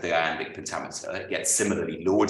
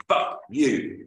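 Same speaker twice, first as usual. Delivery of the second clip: mock hearty comeback, higher and louder; hearty retort; 5 semitones higher, 4 dB louder than usual. Russ MacDonald answering the iambic bore, hearty retort. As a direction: toss it off with cheerful defiance. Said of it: "Russ's response was a hearty".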